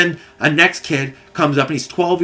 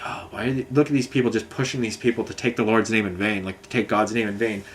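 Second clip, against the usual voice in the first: a soft, low muttering to myself in the background; muttered aside; 4 semitones lower, 6 dB quieter than usual. Retold or imitated. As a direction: grumble it under your breath, softer. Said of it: "I'm in the background just like".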